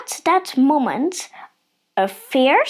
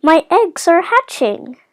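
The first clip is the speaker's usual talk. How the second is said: raised, louder mother duck call; excited calling; 6 semitones higher, 5 dB louder than usual. A narrator's reading. Mother Duck to the other ducks as eggs hatch, calling out. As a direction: announce it eagerly to the flock. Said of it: "called to the other ducks".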